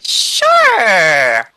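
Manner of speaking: high, loud, long squeal; delighted princess squeal. Acted. Princess agreeing to ride off with the prince, delighted squeal. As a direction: squeal your eager agreement.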